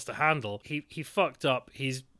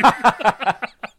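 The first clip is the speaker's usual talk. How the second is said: high burst of laughter; big open laugh; loud laugh; 10 semitones higher, 13 dB louder than usual. Real, not acted. Laugh at own joke.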